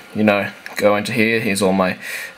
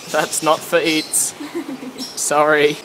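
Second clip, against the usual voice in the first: ordinary delivery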